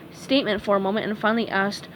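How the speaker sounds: ordinary delivery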